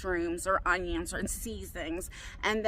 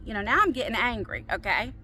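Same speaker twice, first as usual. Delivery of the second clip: ordinary talk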